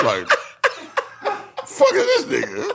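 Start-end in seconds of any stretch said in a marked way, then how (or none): 1.7-2.6 squeaky laughing outrage, much higher; laughing disbelief. Retold or imitated. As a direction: laugh through the words in high disbelief. Retold reaction, high far laugh.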